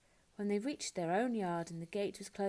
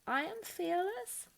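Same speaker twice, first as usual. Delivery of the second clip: unsure self-reassurance; 11 semitones higher than usual. A narrator's reading. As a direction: try to talk yourself into it. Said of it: "tried to convince herself".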